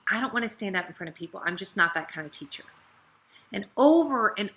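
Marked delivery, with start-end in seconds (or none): 0.1-2.6 soft, fast, reluctant mumble; timid excuse. Retold or imitated. Downline leader dodging teaching again, soft fast timid excuse. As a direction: brush it off quickly and shyly. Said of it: "she kept saying".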